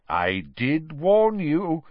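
put-on slow, amused old wizard. A narrator's reading. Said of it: "said a deep, amused voice".

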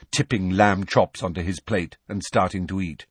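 ordinary delivery

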